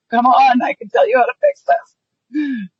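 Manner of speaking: bossy nagging. Retold or imitated. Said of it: "it's hard not to just be like".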